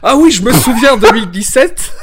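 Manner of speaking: hammed-up Southern French granddad; old grandpa with Southern accent. Teasing Arnaud as a grandpa with Southern French accent, mock reminiscing. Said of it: "on me ramène toujours à mon accent du Sud".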